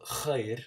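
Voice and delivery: "khair" with a rasping kh from the back of the throat; throaty kh sound. Shown as a demonstration. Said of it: "from the back of your throat".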